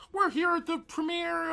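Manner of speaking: high, slow reporter voice; news reporter voice. Retold or imitated. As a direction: ham up the TV news intro. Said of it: "they did one of those things like".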